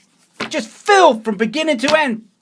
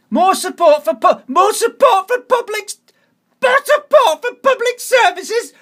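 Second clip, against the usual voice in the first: mock slogan read; 6 semitones higher than usual. Voter mocking the leaflet's promise of support, high sneer. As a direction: sneer the slogan back in a put-on voice.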